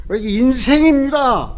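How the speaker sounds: prayer cried out slowly and loudly; anguished cry. Retold or imitated. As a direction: stretch out 인생입니다 as a desperate plea to God. Crying out in retold prayer.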